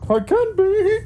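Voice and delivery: tiny, very high hopeful squeak; little mushroom creature. Acted. Mushroom answering whether it can be paladin, high squeak.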